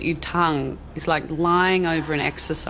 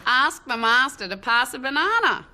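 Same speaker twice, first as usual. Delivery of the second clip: broad Australian accent, no R in "master", pitched higher; 7 semitones higher than usual. Shown as a demonstration. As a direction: say "Ask the master to pass a banana" like a broad Aussie, drop the R. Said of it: "something quite broad".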